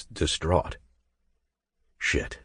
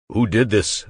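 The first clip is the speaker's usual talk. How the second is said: louder, protective anger; angry demand; 6 semitones higher, 6 dB louder than usual. Acted. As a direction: demand to know, furious. Hero angry seeing her cry.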